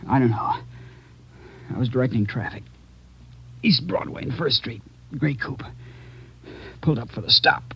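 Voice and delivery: weak, dying. Acted. Dying officer recounts shooting.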